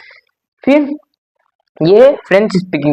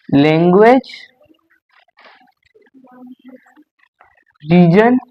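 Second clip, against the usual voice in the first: dictation; much slower than usual. Shown as a demonstration. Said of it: "dictating title to class".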